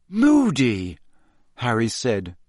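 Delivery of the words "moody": higher-pitched falling query; disbelieving. A narrator's reading. Harry questions who did it, disbelieving. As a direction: say the name in mild disbelief. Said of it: "in a state of complete disbelief".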